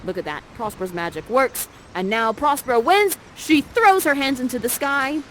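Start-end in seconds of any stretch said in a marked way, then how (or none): none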